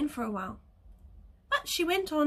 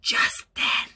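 whisper. A narrator's reading. Whispered story suspense.

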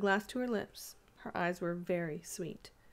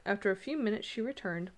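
ordinary delivery, nothing marked

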